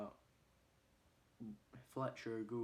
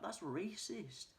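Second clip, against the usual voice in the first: high, drawn-out objection; mock outrage, stretched out; indignant protest; 9 semitones higher, much slower than usual. Boxer complaining to Coach, indignant.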